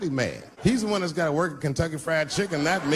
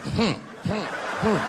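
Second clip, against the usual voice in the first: put-on girlfriend's hums; 4 semitones higher, much slower than usual. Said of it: "so she's like".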